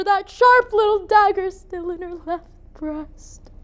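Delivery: high, tearful dramatic outpouring; anguished. Acted. Mother beholds her daughter drained of blood, anguished. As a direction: build to a wailing pitch, then ease slightly.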